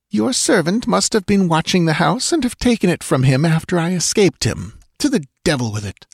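high, pleading-angry woman's voice; bitter retort. A narrator's reading. Dancer demands her lover's mind restored, bitter. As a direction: plead and accuse in one breath. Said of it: "she retorted bitterly".

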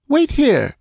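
high-pitched order; commanding. A narrator's reading. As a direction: give a short royal command. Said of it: "she commanded".

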